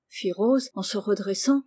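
ordinary delivery, nothing marked

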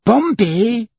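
very high rising question; astonished cry. A narrator's reading. Servant echoes the judge's word, astonished cry. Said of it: "cried out Passepartout".

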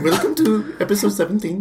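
high, put-on Indian accent, laughing; a joking Indian-accent welcome; mock Indian accent. Host jokes an Indian-accent welcome for friends.

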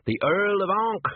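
Earl of Ankh lifted high and loud, savoured; grand title. Acted. Sergeant dangling the title before Nobby, high and proud. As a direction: pitch it up grandly like a prize.

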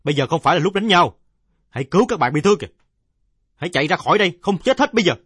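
high, loud urgent shout over the noise; commanding shout, pitched high and loud; loud bark of an order. A narrator's reading. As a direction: yell at the brawling boys to stop. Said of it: "quát to".

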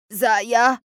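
loud stunned call of her name; shocked cry. A narrator's reading. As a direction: gasp the name out in wild shock. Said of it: "وصاحت في دهشة جنونية". (صرخة دهشة)

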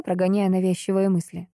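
usual speaking voice

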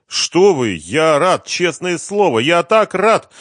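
high, loud, over-eager panic; frightened, overdone eagerness; nervous protesting. A narrator's reading. Terrified man protests to his visitor.

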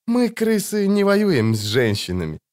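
high mocking echo of мы крысы; Kayley mocks Giselher's line; sneering repeat. A narrator's reading. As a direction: parrot the leader's words with a leer. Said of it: "снова ощерился Кайлей".

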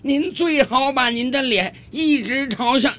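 high, drawn-out shout with a chuckle up front; friendly calling back. Acted. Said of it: "也很友好地冲这位旅游者喊道".

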